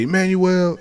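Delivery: loud, high call of the name. Retold or imitated. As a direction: summon the kid across the house. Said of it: "And he'd say".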